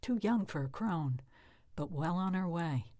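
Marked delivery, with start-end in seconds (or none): none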